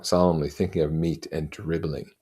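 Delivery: plain reading voice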